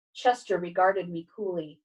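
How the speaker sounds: ordinary delivery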